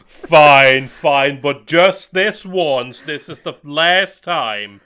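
loud, drawn-out grudging consent; mock giving in. Parent giving in to a begging kid, mock exasperated. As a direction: cave in with exaggerated reluctance, stretching it out.

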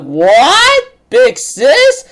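high, loud, disbelieving squeak; mock shocked gasp. The seven deadly sins stunned at Big Sis, a high shocked squeak. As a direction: play the stunned gang hearing Big Sis.

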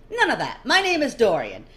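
loud, high, grandly self-assured; brash nobleman. Acted. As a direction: announce yourself like a vain aristocrat. Dorian introduces himself.

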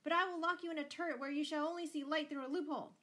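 high, loud, rushed threat; furious bellow. A narrator's reading. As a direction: shout it fast in one angry rush. Father's threats peak in quarrel.

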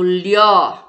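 "lió" low, firm and held long; isolated stressed syllable. Shown as a demonstration. Teacher naming the tonic syllable, "lió" stressed. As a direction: lean hard on a low "lió". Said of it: "que eu falo com mais intensidade".

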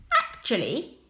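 plain reading voice

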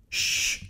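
long soft "shh"; hushing. A narrator's reading. El Fazer silencing Hodja's cheer, hushing. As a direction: shush the boy softly. Old man hushes shouting boy.

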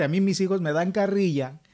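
normal talking voice

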